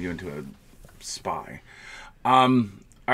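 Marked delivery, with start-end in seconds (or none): 0.0-1.6 whispered punchline. Pretend. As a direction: breathe the last word. Punchline of show joke.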